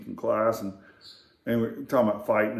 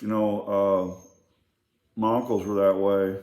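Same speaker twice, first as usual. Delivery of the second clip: ordinary talk, nothing marked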